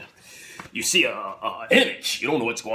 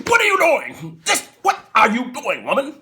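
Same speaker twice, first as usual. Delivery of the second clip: high, loud outraged yell; outraged shout; 11 semitones higher, 6 dB louder than usual. Retold or imitated. Husband yells at his wife at the slot machine. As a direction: bark it like a scandalised husband.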